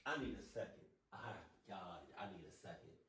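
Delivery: very hushed, barely voiced; faint, drained murmur; overwhelmed. Real, not acted. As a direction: drop to almost nothing, needing to recover. Overwhelmed after watching footage.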